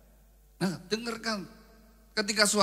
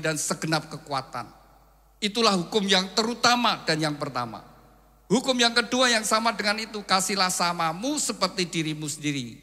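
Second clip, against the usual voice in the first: preaching scripture; 5 semitones higher, 7 dB louder than usual. Acted. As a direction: proclaim the commandment to the congregation. Reads scripture with full force.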